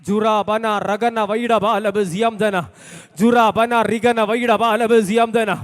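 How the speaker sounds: slow, chanted glossolalia; speaking in tongues. Real, not acted. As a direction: pray aloud in tongues, drawn out.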